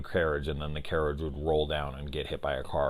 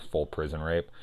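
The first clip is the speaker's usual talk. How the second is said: ordinary talk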